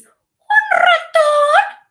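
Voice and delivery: very high, slow squeak with a rising end; super squeaky voice; squeaky mouse voice. Shown as a demonstration. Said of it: "Super squeaky voice".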